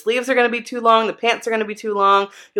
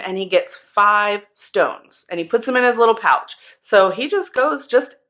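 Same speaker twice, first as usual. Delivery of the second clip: normal talking voice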